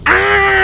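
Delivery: high, loud mock scream; imitated scream. Streamer demonstrates the scream they both let out, high. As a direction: replay the scream for the audience. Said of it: "both me and Sonny screaming, just".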